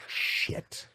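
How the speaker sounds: breathless whispered curse to himself; whispered shit. Retold or imitated. Kid realising the lie is caught, whispered curse. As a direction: hiss it under your breath in dread. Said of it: "Now you're like".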